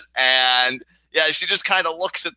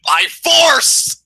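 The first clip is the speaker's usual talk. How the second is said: high, loud menacing punchline then laughing; mock villain; 13 semitones higher, 6 dB louder, much slower than usual. Host playing a villain forcing cuteness on everyone. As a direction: ham it up like a cartoon tyrant. Villain joke about cute ways.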